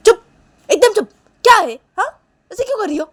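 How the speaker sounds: loud, high, abrupt scolding; angry silencing. Acted. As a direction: shut them up with sharp commands. Sneha slaps and silences Neha. (गुस्से में चुप कराते हुए)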